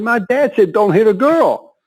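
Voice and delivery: high, pleading excuse; sheepish protest. Retold or imitated. To the trainer, refusing to hit back: high sheepish protest.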